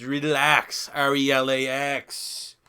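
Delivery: slow spelled-out R E L A X, letter by letter; a mock-calm quarterback telling fans to relax; mocking Aaron Rodgers. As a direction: spell it out slowly like a smug quarterback at the podium. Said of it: "his Aaron Rodgers-like press conference".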